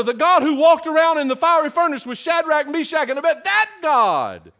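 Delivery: high, driving sermon build; impassioned pulpit delivery; full-force preaching. Real, not acted. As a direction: hammer each "that God" home.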